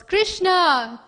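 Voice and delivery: loud, high call across a distance; long drawn call. Acted. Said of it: "she calls".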